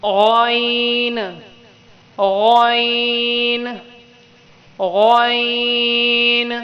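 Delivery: غین held very long, heavy from the throat; drawn-out ghain. Shown as a demonstration. Teacher drilling غین for pupils. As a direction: stretch غین slowly. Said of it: "منہ بھر کے پڑھیں گے".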